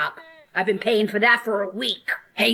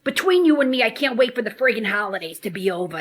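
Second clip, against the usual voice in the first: normal talking voice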